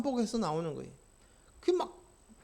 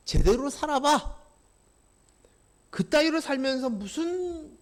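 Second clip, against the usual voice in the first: louder scolding jab; a harsh taunt of the accuser; accusing taunt; 5 semitones higher, 5 dB louder than usual. Acted. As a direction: scold like the accuser mocking a believer. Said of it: "라고 얘기합니다".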